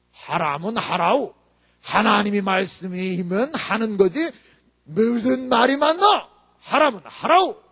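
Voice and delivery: slow, stern North Korean-style speech; North Korean accent. Retold or imitated. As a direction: lightly imitate a strict North Korean pastor. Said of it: "좀 비슷했나 모르겠어요, 제가".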